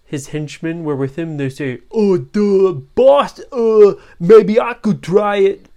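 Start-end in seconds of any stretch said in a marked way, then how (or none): 1.9-5.7 high, slow, dim-witted sidekick voice; a meek henchman eager to try; goofy henchman. Acted. Henchman asks the boss for a turn, goofy high voice.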